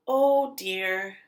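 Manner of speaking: usual speaking voice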